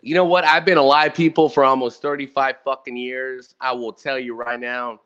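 ordinary delivery

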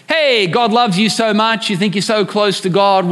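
ordinary delivery

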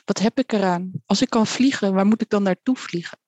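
plain reading voice

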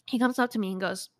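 ordinary talk, nothing marked

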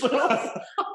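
high, loud burst of laughter; real laugh. Real, not acted. Patient laughs while telling the hospital story. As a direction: crack up at the memory.

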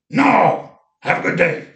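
high rough brush-off; imitating Mark's rough voice. Mark turning down food again, rough imitation. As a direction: wave it away kindly. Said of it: "he had this rough voice".

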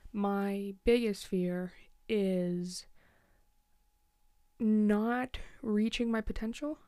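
slow, weighted words, each one set apart; slow confession. Real, not acted. Host admits her deepest fear to listeners, slow and deliberate. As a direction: let the fear sink in slowly.

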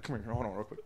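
1 hushed coaxing. Pretend. Luring the kid over, hushed.

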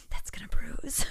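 whispered under the breath; whispered aside. Retold or imitated. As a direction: hush it as a sly aside. Coach aside while poking.

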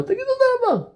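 a preacher crying out to the congregation; fervent exhortation. Acted. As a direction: rouse them to give thanks instead of crying. Preacher urging crowd to thank.

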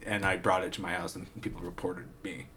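low, soft, hesitant mumble; mock sheepish explanation. Katana owner explaining himself to police, subdued and low. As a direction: explain it quietly, as the imitated suspect.

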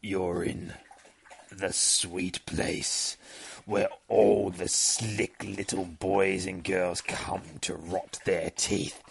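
low, slow, soft creature voice; sinister demon. Acted. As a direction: draw out each word like a luring monster.

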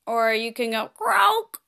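normal talking voice

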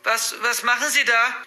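put-on alarmed girl confronting an intruder; alarmed call. A narrator's reading. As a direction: call out in mild panic. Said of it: "rief Sarah".

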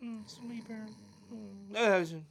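very soft, high sleepy murmur; mock snoring. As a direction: murmur it hushed, as if pretending to snore. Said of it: "we always gotta cue the snore for that".

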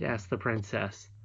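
ordinary delivery, nothing marked

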